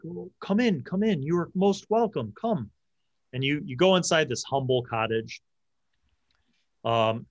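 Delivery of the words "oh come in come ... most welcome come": lightly voiced mother. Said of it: "And she's like".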